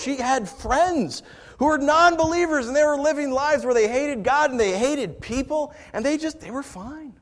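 loud, high, impassioned sermon voice; rising pulpit fervor, loud and pressing; fervent preaching. Acted. Preaching at full force.

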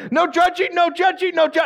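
very high-pitched escalating bickering; mock shouting match. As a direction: shout over each other, hammed up. Argument escalating into endless loop.

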